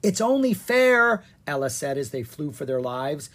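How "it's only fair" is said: loud. Acted. Ella said while fleeing.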